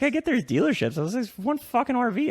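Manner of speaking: pushing back in disbelief, voice up; exasperated retort. Retold or imitated.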